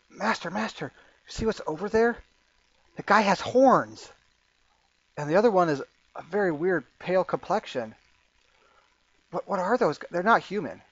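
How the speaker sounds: barmaid. Acted. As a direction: tell the master in a high, questioning voice. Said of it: "you see this barmaid walk, says".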